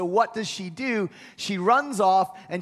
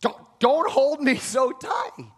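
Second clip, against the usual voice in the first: urgent plea; 5 semitones higher, slower than usual. Retold or imitated. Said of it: "She grabbed him hard".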